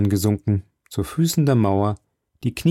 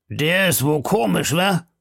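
puzzled grub-man; 8 semitones higher than usual. Acted.

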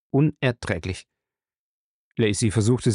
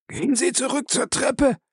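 higher, gasping for breath; 13 semitones higher than usual. Acted. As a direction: pant it out mid-fight. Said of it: "keuchte Jim".

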